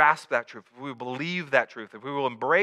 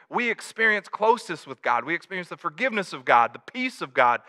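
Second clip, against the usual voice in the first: ordinary talk, nothing marked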